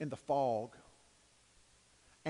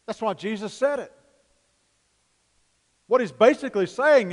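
loud rising preacher's cry, slowing on key words; preaching at full force; 3 semitones higher, 10 dB louder than usual. Acted. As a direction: build it up to a fiery shout from the pulpit. Preaching climax.

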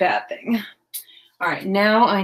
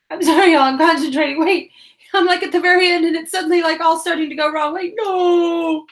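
flustered concentration; 9 semitones higher, 6 dB louder than usual. Real, not acted. Fiddly end of craft, apologizing.

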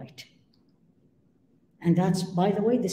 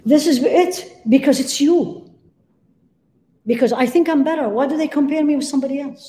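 rising preaching; 6 semitones higher, 6 dB louder than usual. Real, not acted. Preacher rising to full force.

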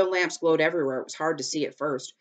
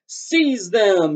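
loud, high ruler's order; shouted command; 5 semitones higher, 9 dB louder than usual. A narrator's reading. Dragon King orders soldiers after the children, shouted command. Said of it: "he shouted".

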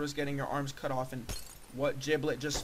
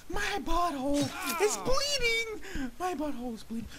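agonized wail; 14 semitones higher than usual. Acted. Crashed rider lamenting injury, high hammed-up agony.

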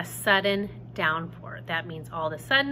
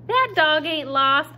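high, loud, slow holler; 7 semitones higher, 7 dB louder than usual. Acted. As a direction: holler to someone inside the house. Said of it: "Sweetie Pie holler".

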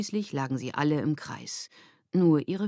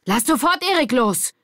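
high, loud, urgent command; alarmed shout; 9 semitones higher, 8 dB louder than usual. A narrator's reading. Lilly finding Erik pressed to the floor, shouting. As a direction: shout to make them stop at once. Lilly finds friend pinned down.

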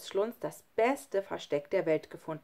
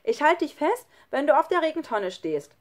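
high, loud, encouraging call; 8 semitones higher, 6 dB louder than usual. A narrator's reading. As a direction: call down to reassure a friend. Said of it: "rief Schlunz". (laut und ermutigend gerufen)

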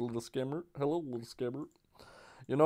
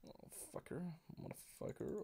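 breathy whispered curse; whispered swearing; 3 semitones higher, 18 dB quieter than usual. Real, not acted. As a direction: hiss the curse under breath.

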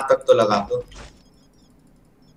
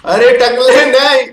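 high, loud, drawn-out protest; panicked shout; 9 semitones higher, 6 dB louder, slower than usual. Real, not acted. Gamer yelling at bald teammate not to kill him, panicked shout. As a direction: cry out as if about to get killed.

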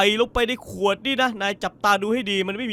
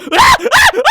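high loud scream of laughter; shrieking laugh; 12 dB louder than usual. Real, not acted. Scared at the night beach, shrieking laugh.

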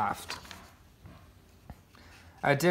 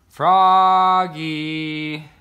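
louder call, from far below; 3 semitones higher, 9 dB louder than usual. A narrator's reading. A father calling his son from far below, loud call. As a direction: call up to him from the ground.

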